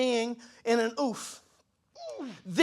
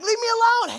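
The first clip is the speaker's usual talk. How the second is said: much higher, louder groaning refusal; annoyed brush-off; 12 semitones higher, 6 dB louder than usual. Pretend. Person yelling at the Spirit who wakes them, mock annoyed. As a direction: whine it like a teen told to get up.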